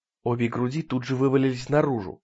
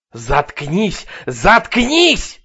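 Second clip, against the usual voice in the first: second one higher and louder; angry bark; 7 semitones higher, 9 dB louder, much slower than usual. Acted. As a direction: snap it at her furiously. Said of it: "рявкнул кассир".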